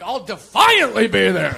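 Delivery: loud, drawn-out proud declaration; defiant shout. Acted. Comic replying to a friend's Christmas question, defiant shout. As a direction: blurt it out far too forcefully.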